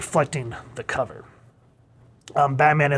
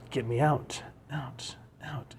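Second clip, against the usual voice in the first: fading whisper; 12 dB quieter, slower than usual. Acted. Seductive plea fading away.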